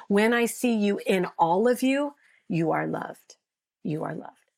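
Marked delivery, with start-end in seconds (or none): 3.8-4.5 much softer, hushed repeat. Real, not acted. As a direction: let it land gently, almost under breath. Repeats the blessing, second softer.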